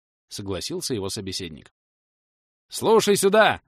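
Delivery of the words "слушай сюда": higher, louder call for attention; teacher calls to the beggars, loud. A narrator's reading. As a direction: grab the crowd's attention. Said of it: "обратился к нищим он".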